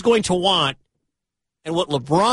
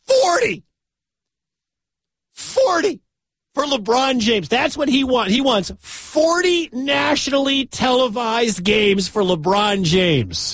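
incredulous shout; 8 semitones higher, slower than usual. Real, not acted. Host outraged by agent's demand.

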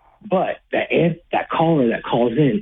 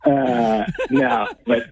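laughing through the words; breaking up with laughter; 10 semitones higher, much slower than usual. Real, not acted. Laughing at caller's claim.

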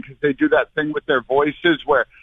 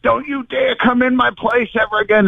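retold yelling; 8 semitones higher than usual. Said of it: "I was yelling and screaming".

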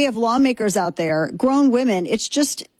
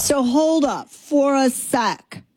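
put-on voice; 6 semitones higher, much slower than usual. Mocking voice carries on.

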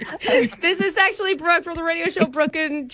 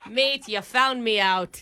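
slow, broad mock-Aussie confession; put-on Australian accent; 3 semitones lower, slower than usual. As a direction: own up in fake Australian. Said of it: "from the land down under".

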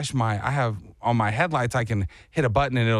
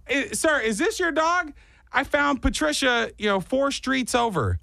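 polite high voice; 11 semitones higher than usual. Retold or imitated. Politely asking the owner.

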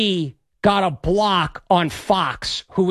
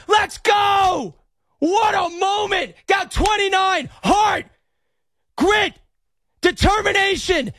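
high, hoarse victory yells, drawn out; ecstatic shouting; 10 semitones higher, slower than usual. Real, not acted. Radio host hailing the Knicks' year, ecstatic shouting. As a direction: roar the win to every listener. Host keeps celebrating the win.

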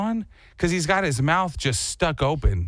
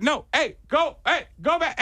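shooing a dog away; 12 semitones higher than usual. Retold or imitated.

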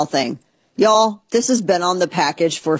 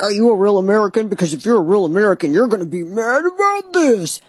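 mock patriot voice; 3 semitones higher than usual. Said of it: "it's like".

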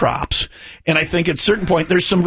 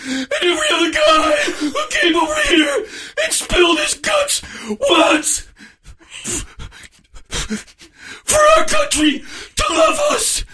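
high, slow, tearful hammed-up wail; Rambo impression; slower than usual. Host doing Rambo's finale, hammed-up crying shout. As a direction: sob out the speech like a broken soldier. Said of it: "I'm John Rambo".

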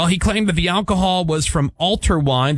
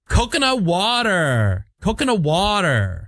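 1 daughter's sing-song; 6 semitones higher, slower than usual. Retold or imitated. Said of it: "She goes".

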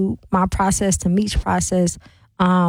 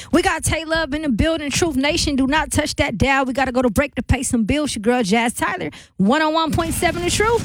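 higher-pitched radio DJ break announcement; upbeat, higher on-air station ID voice; radio station host; 6 semitones higher than usual. Acted. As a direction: throw to the commercial break like a radio DJ. Host sends show to break.

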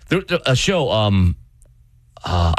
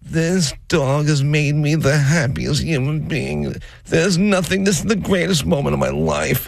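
doting mom; 7 semitones higher than usual. Retold or imitated. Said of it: "She's like".

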